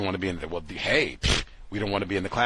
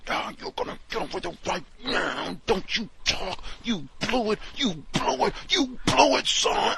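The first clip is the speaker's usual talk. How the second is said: yelling gym coach; 11 semitones higher than usual. Retold or imitated. Mocking the yelling instructor, high gym-coach shout. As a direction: imitate a coach berating a kid, rising higher. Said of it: "gym coaches don't even talk like that".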